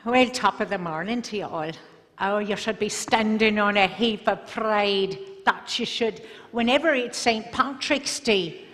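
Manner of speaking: cheery Irish brogue greeting; Irish accent. Shown as a demonstration. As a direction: put on an Irish accent for a crowd. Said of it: "So Irish".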